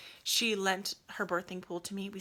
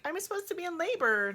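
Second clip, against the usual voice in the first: exasperated; 9 semitones higher, 6 dB louder than usual. Retold or imitated. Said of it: "I'm like".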